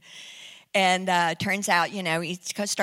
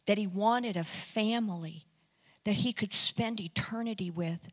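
low, very soft and slow; gently persuade, pausing between phrases; hushed, intimate plea fading away; tender preaching appeal; 6 semitones lower, 9 dB quieter, slower than usual. Retold or imitated. Soft closing appeal to the man.

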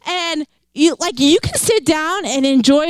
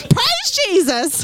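slow, higher Southern preacher drawl on Praise Jesus; 6 semitones higher, slower than usual. Shown as a demonstration. Preacher joking about a Southern anointing, slow Southern drawl. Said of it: "So maybe I should start talking like this".

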